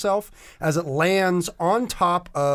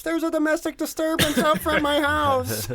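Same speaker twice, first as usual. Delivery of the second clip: much higher fussy neighbor voice on the phone; panicky neighbor; 12 semitones higher than usual. Acted. Neighbor reporting the fight to the cops, high fussy voice. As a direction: tattle to the police like a nosy neighbor. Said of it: "calling the cops".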